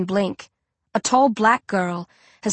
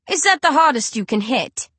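mocking; 5 semitones higher than usual. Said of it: "I mock her".

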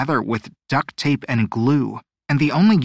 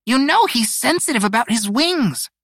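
high-pitched scolding; snappish; 11 semitones higher than usual. A narrator's reading. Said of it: "Pico snapped".

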